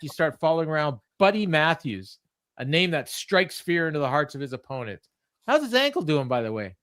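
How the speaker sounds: normal talking voice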